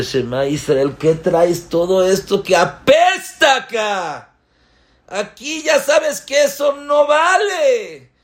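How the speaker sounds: a wife at the port shouting at her husband in disgust. Acted. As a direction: scold him hard for the stinking load. Wife scolds about the stench.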